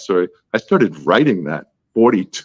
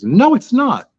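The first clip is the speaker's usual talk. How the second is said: high-pitched retold objection; mock contradiction; 13 semitones higher, 4 dB louder, slower than usual. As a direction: imitate an editor firmly disagreeing. Acted argument, editor contradicts.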